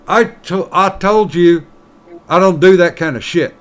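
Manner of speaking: lightly voiced miner insisting; miner in a joke.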